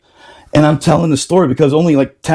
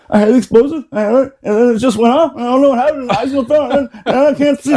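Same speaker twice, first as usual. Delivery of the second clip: high, frantic pleading voice, pitched way up; panicked injured Marine; 10 semitones higher, faster than usual. Retold or imitated. To the lieutenant after the blast, high panicked pleading. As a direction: mimic a blinded corporal begging after the blast. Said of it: "he's going like this".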